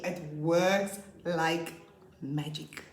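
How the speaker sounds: drawn out, very slow and emphatic; slow emphasis. Real, not acted. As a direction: stretch every word to sell it. Teacher stressing her point.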